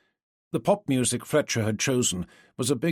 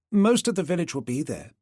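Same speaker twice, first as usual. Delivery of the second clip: put-on English accent; 6 semitones higher than usual. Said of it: "dialed down her transatlantic accent and dialed up the English to sound more like May".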